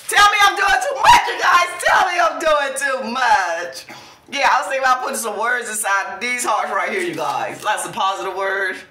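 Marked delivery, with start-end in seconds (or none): 0.1-3.9 laughing. Real, not acted. Laughing self-tease to viewers.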